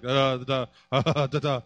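low, soft, stunned da da da; dumbfounded babble. Acted. Job stunned silent by God's questions, low dazed babble. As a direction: Job can only babble back, lost for words. Job voiced, dumbstruck before God.

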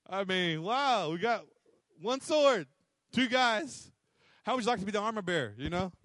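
ordinary talk

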